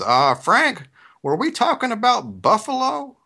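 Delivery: a hint of the mayor's drawl, puzzled. Mayor calling the reporter, puzzled, in his Southern drawl. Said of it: "the mayor says".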